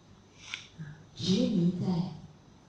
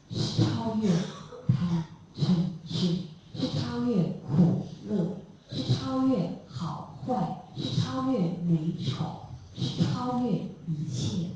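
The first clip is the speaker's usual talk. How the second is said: slow, measured, each phrase set apart; slow solemn proclamation; slower than usual. Acted. Preacher telling the listeners what the far shore is, slow and solemn. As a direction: lay out each line slowly, like a vow. Climax of the sermon, reaching the shore.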